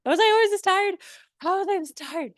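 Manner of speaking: high-pitched, incredulous self-questioning; whiny, put-on disbelief at yourself; mock bewildered whine. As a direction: act out baffled exhaustion in a high voice. Acting out post-project exhausted self-questioning.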